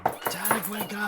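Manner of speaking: high, loud wake-up call; calling someone awake. Acted. Someone pounding on the door to wake Tadek, loud high call. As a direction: shout through the door to rouse a sleeper.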